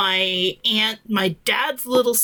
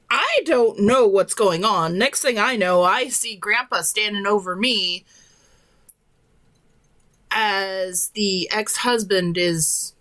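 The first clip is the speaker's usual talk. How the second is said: ordinary talk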